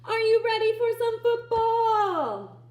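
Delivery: high, loud announcer boom; booming game-day announcer call; sports announcer hype. Acted. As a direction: pump up the crowd like a stadium announcer. Announcer-style hype line.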